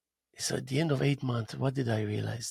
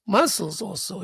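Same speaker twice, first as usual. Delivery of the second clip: ordinary talk, nothing marked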